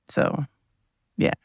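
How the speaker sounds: usual speaking voice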